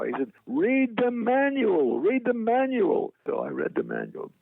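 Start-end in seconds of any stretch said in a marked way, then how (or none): 0.5-3.1 high, slow singsong cry; imitated high-pitched, stretched-out exhortation; Mel Brooks. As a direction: draw it out way up high, twice over. Imitates Mel Brooks calling out advice.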